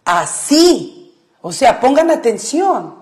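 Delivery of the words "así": "Así" con la I acentuada y alargada; acento en la I. Shown as a demonstration. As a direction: carga la voz en la I final de "Así". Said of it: "Porque usted está diciendo". ("Así" con énfasis en la última sílaba)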